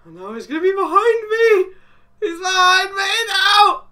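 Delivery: very high, loud squealing wail; panicked wail. Real, not acted. Player warning the monster is behind him, panicked wail. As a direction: climb into a tearful falsetto panic.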